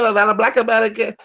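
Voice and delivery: high, fast babbling parody of a rushed caller; sneering high-pitched jabber; mocking gibberish imitation. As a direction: rattle off mock nonsense syllables to ridicule him. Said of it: "He said".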